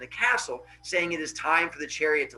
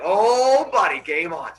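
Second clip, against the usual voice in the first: excited; 7 dB louder than usual. Acted. Phaethon gets his wish, excited. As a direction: crow it, then settle into eagerness. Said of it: "So Phaethon gets excited".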